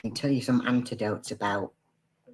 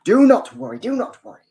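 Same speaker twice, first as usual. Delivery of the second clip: "do not worry" in a jokey Scottish accent, pitched up; mock Scottish accent; 9 semitones higher, 7 dB louder, faster than usual. Shown as a demonstration. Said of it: "Oh, yes, I will do Scottish".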